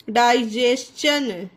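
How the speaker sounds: slow डाइजेशन, "dai-jes-chun"; ch sound for T. Shown as a demonstration. Teacher showing how it reads, slow डाइजेशन with "ch". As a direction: spread out डाइजेशन with a clear "chun". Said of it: "इसे क्या पढ़ेंगे".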